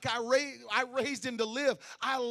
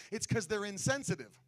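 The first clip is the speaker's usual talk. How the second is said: ordinary talk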